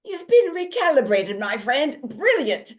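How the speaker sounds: high, loud, hammed-up British accent; bad British accent. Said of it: "in my very bad British accent".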